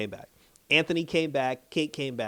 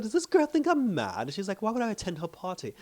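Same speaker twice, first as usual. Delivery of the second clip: haughty queen; 7 semitones higher than usual. Retold or imitated. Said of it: "She said".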